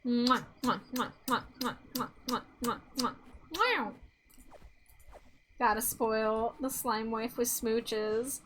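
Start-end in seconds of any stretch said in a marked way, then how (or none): 0.1-3.9 string of playful smacking kisses; between kisses. Pretend. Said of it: "And then ten more".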